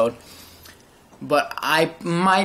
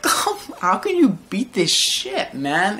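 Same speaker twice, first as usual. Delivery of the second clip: airy gasp then higher, slower outcry; mock disbelief; 7 semitones higher, slower than usual. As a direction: act floored by the scene. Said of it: "And I'm like".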